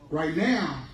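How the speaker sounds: ordinary delivery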